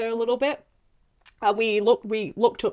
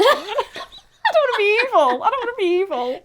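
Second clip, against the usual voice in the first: laughing whine; 13 semitones higher, 6 dB louder than usual. Acted. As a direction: giggle through the refusal. Laughing refusal to play evil.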